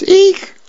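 very high, short "eek"; mock squeal. Daughter sees the topknot Dad gave her, high mock squeal. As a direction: squeak out a comic-strip eek.

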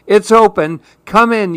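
high-pitched storybook call, mild yell. A narrator's reading. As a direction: call to someone at the door. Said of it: "yelled Susan".

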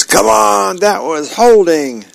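dad's high-pitched yell at the TV; mild yell. A narrator's reading. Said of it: "Dad jumps up out of his recliner and yells".

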